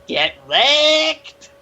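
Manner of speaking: high, loud gloating yell; shouted taunt. Acted. Ialon taunting in the game, high drawn-out shout. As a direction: yell it out, stretching "wrecked".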